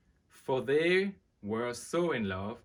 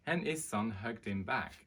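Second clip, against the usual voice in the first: usual speaking voice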